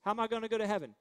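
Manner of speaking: usual speaking voice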